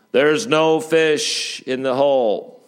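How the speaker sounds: loud, slow, insistent proclamation; booming, stretched-out announcement; voice from above. Acted. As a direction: repeat the warning like an unseen voice on high. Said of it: "he hears a voice".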